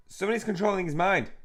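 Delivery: high, loud alarm; alarmed cry. Acted. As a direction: raise the alarm in a panic. Alarm at possessed game character.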